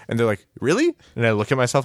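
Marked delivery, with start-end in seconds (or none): 0.6-0.9 very high, rising doubt; mock astonishment; incredulous question. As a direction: question it in a squeaky disbelieving pitch. Acted doubt about claimed height.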